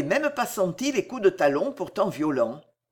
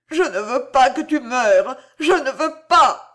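higher, louder, pleading through sobs; sobbing plea; 6 semitones higher, 7 dB louder than usual. A narrator's reading. Boy sobbing to his mistress, begging her not to die. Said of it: "il répétait dans des sanglots convulsifs".

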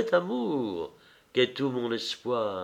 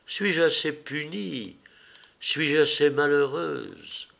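usual speaking voice

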